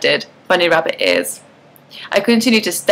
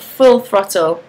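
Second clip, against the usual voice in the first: plain reading voice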